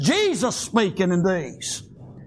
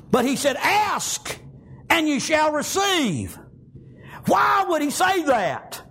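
fervent preaching; 8 semitones higher than usual. Real, not acted. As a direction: preach it with conviction to the congregation. Preaching with force.

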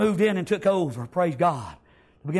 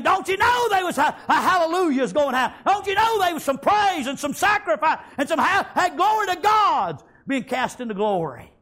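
exultant shouting; 6 semitones higher than usual. Real, not acted. Preacher exulting, congregation hype.

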